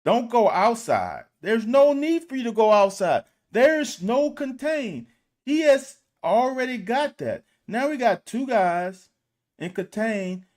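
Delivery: loud, high exasperated outburst; furious film-room rant; frustrated yelling. Real, not acted. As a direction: yell at the player on the screen.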